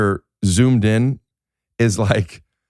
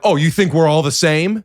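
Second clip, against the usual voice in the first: raised-pitch offended retort; mock indignation; 8 semitones higher, 3 dB louder than usual. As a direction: act affronted, voice pitched up.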